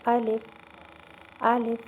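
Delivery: الف loud, each ending halted on the "f"; stopped final sound. Shown as a demonstration. Teacher drilling the correct reading, الف stopped at the final sound. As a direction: repeat الف firmly, stopping dead at the "f". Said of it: "بس اسے روک دینا".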